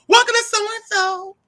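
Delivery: high, sweet greeting voice; chirpy work voice. Retold or imitated. Welcoming customers at work, high chirpy voice. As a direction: sing it out like a cheerful server.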